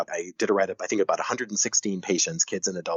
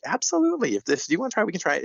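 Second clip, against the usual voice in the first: eager encouragement; 10 semitones higher, faster than usual. Retold or imitated. Doctor urging the mom to try the lotion, eager and high. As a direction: cheer them on to give it a go.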